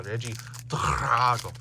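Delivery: mock Italian accent with a heavily emphasized name; emphatic Italian accent. Shown as a demonstration. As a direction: lean hard into the fake Italian. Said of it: "I really have to put emphasis on".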